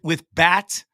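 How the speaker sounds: usual speaking voice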